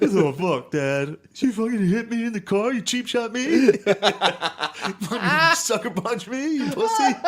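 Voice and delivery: put-on high, wailing teenage accusation; drawn-out bawling protest, breaking into laughter; mock crying outburst. Son accusing dad of cheap-shotting him, mock crying outburst.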